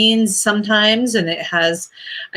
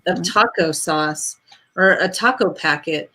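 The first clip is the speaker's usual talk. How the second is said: normal talking voice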